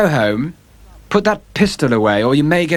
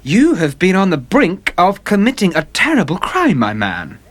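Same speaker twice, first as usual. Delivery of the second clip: stern warning; 6 semitones higher than usual. A narrator's reading. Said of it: "looking at him sternly".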